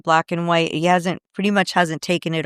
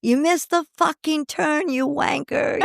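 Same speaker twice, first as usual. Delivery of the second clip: higher mock British rocker rant; 9 semitones higher than usual. Mimicking [name] as a GPS voice, mock British. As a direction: swear like a cranky rock star. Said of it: "thinking him going".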